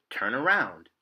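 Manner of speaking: plain reading voice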